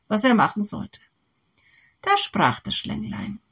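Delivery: usual speaking voice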